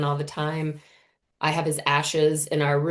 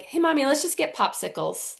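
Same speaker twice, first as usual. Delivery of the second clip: a hint of a cheery little boy, pitched up; light child voice; 8 semitones higher than usual. Son breezily changing the subject with mom, lightly childlike. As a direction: play the carefree toddler lightly.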